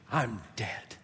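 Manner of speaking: low, quiet and spent; hushed admission of doom. Acted. Preacher as Isaiah facing his doom, hushed despair. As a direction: drop right down after the shouting. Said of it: "Isaiah was saying".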